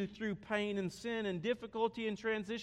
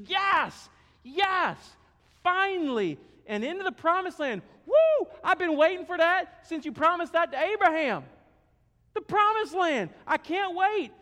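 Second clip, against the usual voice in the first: much higher, louder whooping delight; overjoyed Moses; 9 semitones higher, 10 dB louder than usual. Acted. Moses thrilled at hearing of the promised land, whooping. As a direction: cheer like the wait is finally over. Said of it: "And, and Moses is going".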